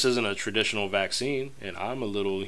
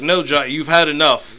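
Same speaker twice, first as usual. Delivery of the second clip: loud, firm refusal; loudly refusing a regular customer; mock cut-off; 3 semitones higher, 7 dB louder than usual. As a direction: cut him off sharply. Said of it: "they're like".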